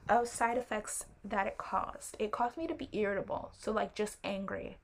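ordinary delivery

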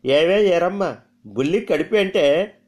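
put-on drawling old mother. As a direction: drag each word out, sizing the girl up. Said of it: "సాగదీస్తూ అంది". (సాగదీస్తూ, ఎత్తైన గొంతుతో అనుమానంగా)

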